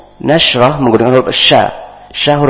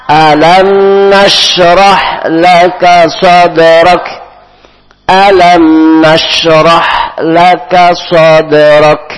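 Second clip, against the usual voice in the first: slow, louder, melodic tilawah; Quran recitation; 8 semitones higher, 8 dB louder, much slower than usual. Shown as a demonstration. Teacher leading the class to repeat the verse, slow chant. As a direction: draw out each phrase in a chant for students to echo.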